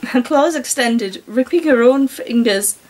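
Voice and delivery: ordinary delivery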